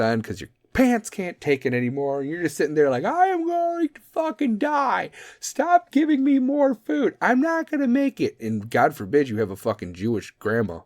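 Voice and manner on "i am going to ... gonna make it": high, slow, agonised whine of a man who ate too much; overstuffed groan. Pretend. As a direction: whimper like you're about to burst from food. Groaning while stuffed at Thanksgiving.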